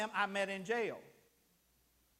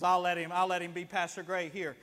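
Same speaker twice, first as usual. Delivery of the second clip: normal talking voice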